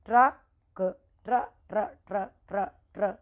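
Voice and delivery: ट्र repeated with a hard rolled r, the first ट्रक drawn out slowly; drilled syllable. Shown as a demonstration. Teacher drills ट्र sound for children. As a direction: drill ट्र over and over crisply. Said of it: "यहाँ पर नीचे लगा है ट्रक".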